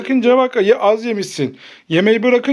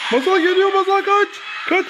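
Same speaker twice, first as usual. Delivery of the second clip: high-pitched play-panicked warning; pretend panic; 9 semitones higher than usual. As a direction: urgently warn her to run, voice pitched up. Pretend monster chase, urging child to flee.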